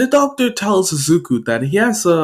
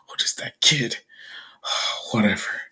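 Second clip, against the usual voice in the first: very soft, whispery groan; wounded gasping; 4 semitones lower, 9 dB quieter than usual. Acted. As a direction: whisper it weakly through pain. Wounded man left gasping.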